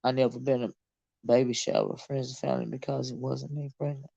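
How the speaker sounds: soft, low reading voice; reading quietly. Real, not acted. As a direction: murmur the comment aloud. Reading chat comment quietly.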